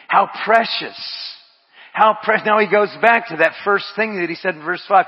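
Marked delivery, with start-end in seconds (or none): none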